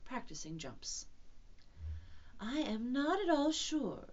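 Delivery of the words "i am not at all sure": slow, musing and a little higher; thoughtful. Acted. Kanga considering a cold bath for Roo, thoughtful. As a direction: draw it out as if pondering. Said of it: "said Kanga in a thoughtful voice".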